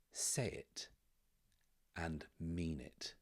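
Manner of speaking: hushed, measured command with spaces between; quietly insist, slowly and gravely; solemn demand. Acted. Governess holding out the blade to Gideon, quiet solemn demand.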